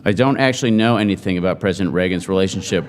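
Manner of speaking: normal talking voice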